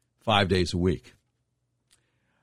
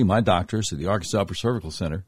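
ordinary talk, nothing marked

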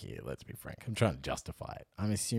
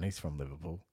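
ordinary talk

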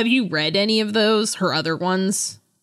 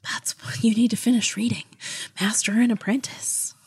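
mock whisper; 3 semitones higher than usual. Nagging co-host about the book.

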